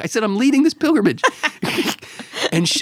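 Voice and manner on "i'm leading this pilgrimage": higher, insistent mock protest; exasperated retelling. Pilgrimage leader keeps trying to explain, exasperated mock protest. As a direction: explain it yet another time, pitched up. Retold exasperated repeated answer.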